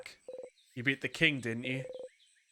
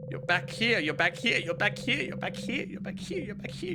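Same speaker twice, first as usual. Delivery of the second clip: rising higher and fading to a near whisper; echoing repetition; 7 semitones higher than usual. Acted. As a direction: let each repeat climb and thin out. Echoing narration rising to high whisper.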